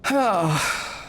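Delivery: real sigh at a setback; heavy sigh. Real, not acted. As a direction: let out a dismayed sigh. Setback in game.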